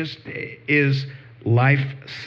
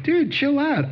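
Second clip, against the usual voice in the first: amused calming; 13 semitones higher than usual. Retold or imitated. Calming down bouncing Mike.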